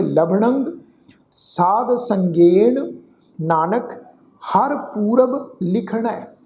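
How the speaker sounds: slow, drawn-out chant of the last line; slow recitation. Shown as a demonstration. Teacher repeats the line for the congregation, slow chant. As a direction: repeat the line slowly for listeners. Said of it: "ਇਹਨੂੰ ਇੱਕ ਵਾਰ ਫਿਰ ਤੋਂ ਸੁਣੋ ਜੀ".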